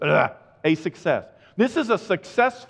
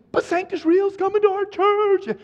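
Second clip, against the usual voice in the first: high, gushing mock churchgoer; mock excited gush; 5 dB louder than usual. Mimicking church members thrilled about a band, high gush. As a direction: squeal it like a thrilled fan.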